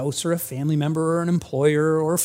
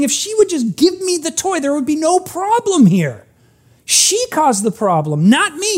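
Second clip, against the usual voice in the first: put-on much higher childish pleading voice; whiny kid blaming; 9 semitones higher than usual. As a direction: plead like a kid who insists it's her fault. Billy voiced blaming Annie.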